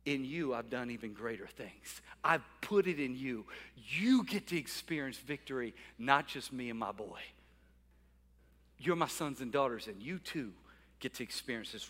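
low, soft voice of God; God speaking tenderly to his children, low and soft; voicing God. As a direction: speak it gently like a loving father.

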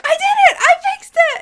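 very high, loud excited squeal. Retold or imitated. Mom thrilled at fixing her sink, squealing high and loud. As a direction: shriek with joy at fixing it. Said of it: "she's so excited".

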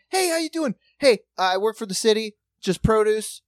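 breezy friendly neighbor calling out; cheery neighbor. Retold or imitated.

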